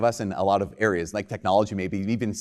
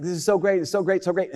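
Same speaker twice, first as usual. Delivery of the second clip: higher-pitched gushing binge-watcher; mock excitement; 7 semitones higher than usual. Binge-watcher raving about a series, mock excitement. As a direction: gush it in a higher voice.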